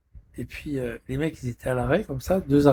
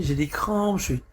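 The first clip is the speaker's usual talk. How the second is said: ordinary delivery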